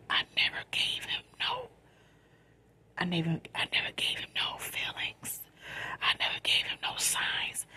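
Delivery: soft late-night whisper; quiet at night. Real, not acted. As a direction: whisper so nobody wakes up. Late-night storytime, hushed.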